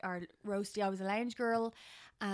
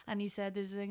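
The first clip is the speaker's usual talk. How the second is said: ordinary talk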